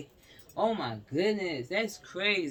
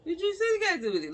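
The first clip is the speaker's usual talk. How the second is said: much higher, louder, very fast; exasperated squeal; 6 dB louder, much faster than usual. Real, not acted. Ranting to viewers about the city name, squealing. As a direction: shoot it up in disbelief.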